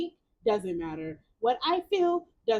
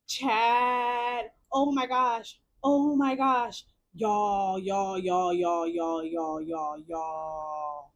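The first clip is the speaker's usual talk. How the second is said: drawn-out, sinking repeated exclamations; stunned amazement; 4 semitones lower, much slower than usual. Real, not acted. Streamer to chat after a plot reveal, stunned drawl. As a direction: let the shock stretch each word, pitch steadily dropping.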